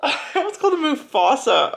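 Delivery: giggling through the words; laughing. Real, not acted. Reader laughing at her own name slip, giggling.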